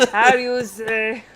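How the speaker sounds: put-on horrible French accent. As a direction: giggly fake French accent. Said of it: "horrible French accent".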